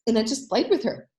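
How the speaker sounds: ordinary talk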